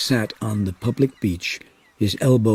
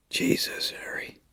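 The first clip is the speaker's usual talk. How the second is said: whisper; 9 dB quieter than usual. A narrator's reading. Brody stunned by headline.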